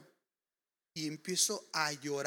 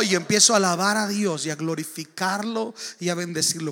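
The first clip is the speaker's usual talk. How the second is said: ordinary talk, nothing marked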